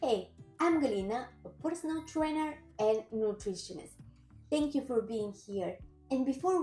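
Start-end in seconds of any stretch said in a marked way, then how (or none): none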